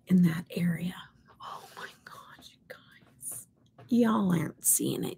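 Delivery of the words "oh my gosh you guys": hushed, barely audible amazement; awed whisper. Real, not acted. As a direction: whisper it to your viewers in awe. Awed aside about her makeup.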